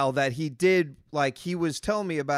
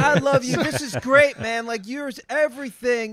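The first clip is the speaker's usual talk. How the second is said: put-on gushing drunk; 7 semitones higher, 5 dB louder than usual. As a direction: ham up a sloppy drunk declaring love. Drunk stranger gushing at narrator.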